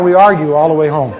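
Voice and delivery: normal talking voice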